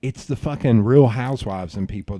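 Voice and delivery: usual speaking voice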